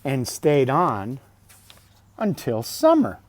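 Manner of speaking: bright, lifted storybook voice; reading to children. Acted. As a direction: read it out like a bedtime book. Reading picture book aloud.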